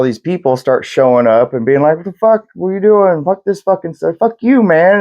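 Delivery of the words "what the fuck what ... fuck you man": higher, mock-angry crowd yelling; angry crowd shout. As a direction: play the furious mob, pitched up. Angry crowd confronting the soldier.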